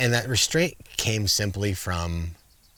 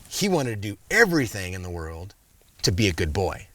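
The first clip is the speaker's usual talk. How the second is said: usual speaking voice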